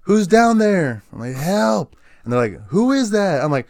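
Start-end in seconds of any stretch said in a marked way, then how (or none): 0.0-1.0 higher, louder, very slow call down a well. Retold or imitated. Squad mates calling down into the well, higher, louder and slow. 1.3-2.0 calling for help. Retold or imitated. Calling up from the well. 2.7-3.4 calling down. Retold or imitated. Squad calling down the well.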